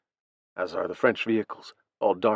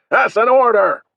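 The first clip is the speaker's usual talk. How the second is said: angry shouted order, raised and loud; growled command; 12 semitones higher, 9 dB louder, slower than usual. A narrator's reading. Officer to his hesitating troops, growled shout. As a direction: growl it at full force, demanding obedience. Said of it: "Danforth growls to them".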